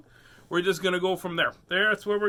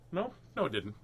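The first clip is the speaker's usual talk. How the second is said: usual speaking voice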